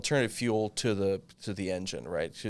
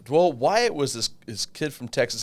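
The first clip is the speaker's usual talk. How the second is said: ordinary talk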